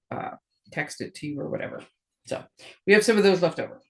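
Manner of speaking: ordinary talk, nothing marked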